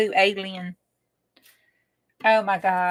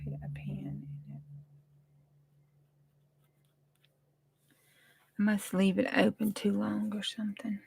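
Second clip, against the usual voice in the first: whispered aside; 8 dB quieter than usual. Real, not acted. Whispered aside while crafting.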